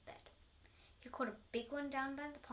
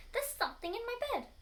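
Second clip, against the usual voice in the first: high, loud and fast panic; panicked scream; 12 semitones higher, 8 dB louder, faster than usual. Acted. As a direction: shriek it in terror, rushing. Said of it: "screamed Missus Twit".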